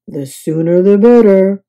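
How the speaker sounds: a tired, grumbly Mister Snore; worn out and wanting bed; sleepy grumpy guest. A narrator's reading. Guest to bellhop, tired and grumbling. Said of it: "said Mister Snore".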